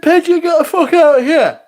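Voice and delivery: high, loud shout at the bird; angry yell. Retold or imitated. Shooing the pigeon out of the cafe, a high loud yell. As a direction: yell it to shoo it away.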